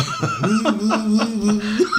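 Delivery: loud, high-pitched burst of laughter. Real, not acted. Laughing at his own singing story.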